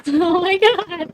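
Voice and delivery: laughing nervously, high and slow; anxious giggle through the words; nervous laughter. Real, not acted. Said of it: "I'm laughing 'cause I'm nervous".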